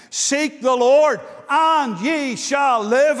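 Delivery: loud, slow, ringing proclamation; proclaimed scripture. Shown as a demonstration. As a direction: call the verse out slowly to the hall. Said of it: "it says".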